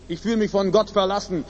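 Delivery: usual speaking voice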